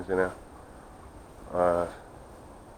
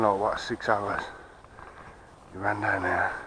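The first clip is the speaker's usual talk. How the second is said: ordinary delivery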